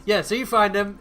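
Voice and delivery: ordinary delivery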